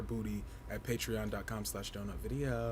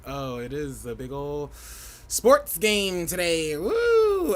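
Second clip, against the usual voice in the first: slow, drawn-out hype that ends in a loud high whoop; mock sports fan; 4 semitones higher, 6 dB louder, slower than usual. To viewers, mocking game-day hype, a slow hammed-up fan cheer. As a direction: ham up a hyped game-day bro, then a big whoop. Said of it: "I was like".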